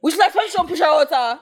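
high, quick mimicry with laughter in it; imitating indignant man. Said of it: "And he's like".

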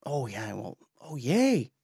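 a hint of sarcastic, soft-spoken scoff; mock smug dismissal. Mocking self-righteous believers, a mild sarcastic scoff. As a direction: lightly mimic a smug churchgoer brushing others off. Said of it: "you're better than them kind of mentality".

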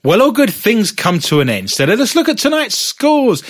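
normal talking voice

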